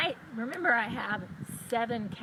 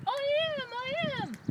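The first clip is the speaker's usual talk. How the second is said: eager blue cow; 13 semitones higher than usual. Acted. Blue cow answers the farmer.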